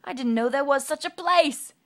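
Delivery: loud, high amazed line; Mister Happy wonders aloud; surprised wonder. Acted. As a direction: marvel at the news.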